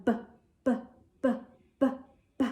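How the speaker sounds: short voiced b sound repeated; b, b, b as a clipped bouncy phonics sound; letter B sound. Shown as a demonstration. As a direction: phonics demo of b. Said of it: "makes the sound of the letter B by going".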